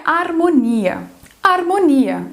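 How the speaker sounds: "harmonia" with all vowels full, Portuguese style; every vowel pronounced. Shown as a demonstration. Teacher contrasting Portuguese for learners, "harmonia" with full vowels. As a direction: sound out each vowel of "harmonia", stressed on "ni". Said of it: "falando todas as vogais dessa palavra".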